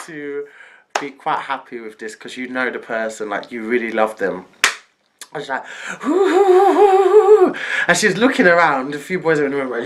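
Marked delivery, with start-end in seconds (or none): 6.1-7.5 loud, high, long "ooh"; hammed-up high ooh of curiosity; intrigued "ooh". Auntie wondering who the boyfriend is, intrigued.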